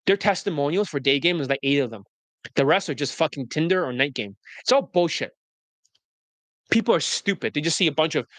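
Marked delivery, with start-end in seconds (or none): none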